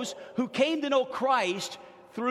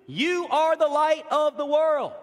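slow, high and loud, each word held; proclaimed; 5 semitones higher, 5 dB louder, slower than usual. Acted. Pastor telling his congregation they are the light, proclaiming slowly. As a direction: announce it as the big answer.

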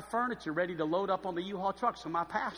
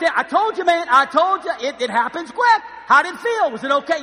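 loud, high-pitched excited needling; animated I-told-you-so; 9 semitones higher, 14 dB louder than usual. Retold or imitated. Teen telling the kissed pastor "told you", excited. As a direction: crow it over the shocked pastor. Teasing the freaked-out pastor after kiss.

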